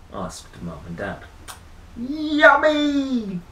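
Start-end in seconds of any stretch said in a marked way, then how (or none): 2.0-3.4 high, loud delighted yell; shouted. Acted. Rory answering his parents about the babysitter, gleeful shout. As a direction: cry out with glee. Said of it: "shouted Rory".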